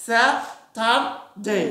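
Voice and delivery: স্যাটারডে slow, American accent, r sounded; teacher showing the American স্যাটারডে with a rolled-in r, very slowly; American pronunciation. Shown as a demonstration. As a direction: stretch out স্যাটারডে the American way with a clear r. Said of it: "আমেরিকানরা কি বলবে?".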